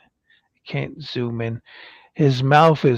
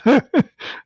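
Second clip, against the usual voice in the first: bright high laugh; 11 semitones higher, 6 dB louder than usual. Real, not acted. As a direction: laugh at the joke. Laughs at viewer comment.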